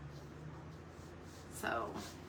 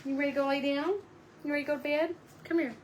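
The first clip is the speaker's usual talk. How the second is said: higher-pitched coaxing questions; sweet pet talk; 5 semitones higher, much faster than usual. Real, not acted. Talking to the pet dog.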